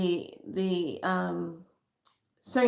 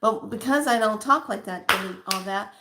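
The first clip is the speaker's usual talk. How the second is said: ordinary talk, nothing marked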